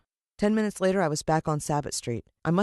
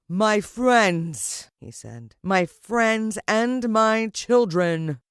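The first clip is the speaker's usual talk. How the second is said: louder, slow, triumphant villain proclamation; grand villain address; 4 semitones higher, 6 dB louder, much slower than usual. Acted. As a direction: spread your arms and own the crowd. Villain addresses his ants.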